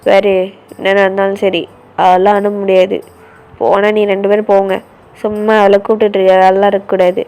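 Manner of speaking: low, loud telling-off; angry brother on the phone. Acted. Brother scolding his sister's friends over the phone, low and angry. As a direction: warn the friends off, fast and firm.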